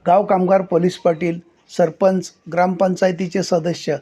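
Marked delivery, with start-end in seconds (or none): none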